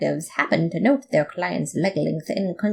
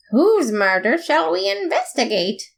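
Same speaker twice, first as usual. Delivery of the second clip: excited question; 10 semitones higher than usual. Acted. Eager detective question.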